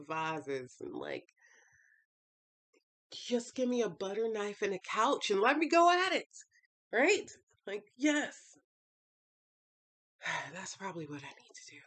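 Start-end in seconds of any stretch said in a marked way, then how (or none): 3.1-8.6 louder and higher, building excitement; fired-up. Real, not acted. Imagining a rage room, getting louder with excitement. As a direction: rev up at the thought of smashing things. Fantasizing about smashing things.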